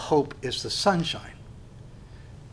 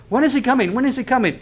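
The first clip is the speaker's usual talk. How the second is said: higher-pitched, insistent repeated question; eager questioning; 9 semitones higher, 4 dB louder, faster than usual. Acted. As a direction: imitate an impatient listener asking. Voicing the listeners' eager question.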